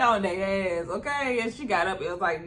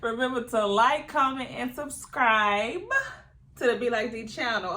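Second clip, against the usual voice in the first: outro pitch; 3 semitones higher, 4 dB louder than usual. Real, not acted. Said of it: "channel outro call".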